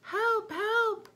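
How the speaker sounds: very high, loud cries for help; cry for help. A narrator's reading. Lady calling for help over her ruined hat, high shriek. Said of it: "Lady Fitztulip screamed".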